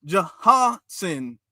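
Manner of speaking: "Johnson" split into slow, separate syllables; mock sounding out. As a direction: mockingly stretch and break up "Johnson". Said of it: "he's sounding it out".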